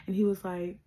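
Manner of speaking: ordinary talk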